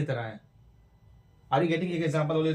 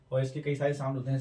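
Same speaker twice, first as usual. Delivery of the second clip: normal talking voice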